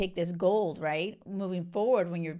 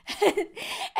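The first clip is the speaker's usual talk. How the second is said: high delighted giggle; 12 semitones higher, 3 dB louder than usual. Real, not acted. Vlogger tickled by her own Spain shout-out, high giggle. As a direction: burst of a high happy laugh. Excited laugh about Barcelona.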